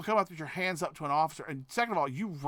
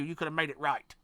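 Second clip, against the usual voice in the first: ordinary talk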